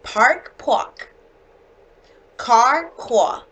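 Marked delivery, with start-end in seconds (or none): 0.6-1.1 park said pahk, no r, Boston style; Boston accent park. Shown as a demonstration. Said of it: "Kind of mixed up". 3.0-3.4 qua as Boston car, no r, open ah; Boston accent car. Shown as a demonstration. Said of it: "Kind of mixed up".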